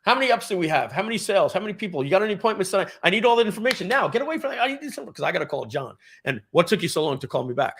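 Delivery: high, pushy, rapid-fire demands; jerk boss. Retold or imitated. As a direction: bark questions like an impatient jerk manager. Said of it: "you turn into this jerk".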